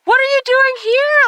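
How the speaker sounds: high-pitched imitation of a nosy doctor; mock doctor. Doctor questions patient's presence, high mocking imitation. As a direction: mimic his question with the voice pushed way up. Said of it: "he, like, next goes".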